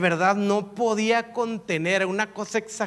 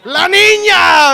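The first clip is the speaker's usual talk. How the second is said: loud, high, drawn-out cry of alarm; startled shout; 10 semitones higher, 17 dB louder, much slower than usual. Retold or imitated. Father startled by his daughter's voice at night, shouting. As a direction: yell it out in a fright.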